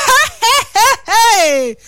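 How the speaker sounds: high laughing hey hey; laughing protest. Retold or imitated. A mother laughing at her child's excuse about the teacher. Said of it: "And I'm like".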